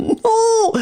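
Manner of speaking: non shrieked very high; protesting a wrong sentence in outrage; mock-outraged no. Real, not acted. Teacher protests a funny student mistake.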